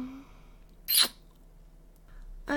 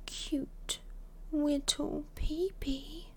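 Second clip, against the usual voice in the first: teasing whisper; 2 semitones higher, 8 dB quieter, slower than usual. Acted. Teasing baby talk.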